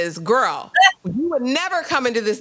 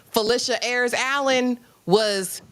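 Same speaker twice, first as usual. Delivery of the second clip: usual speaking voice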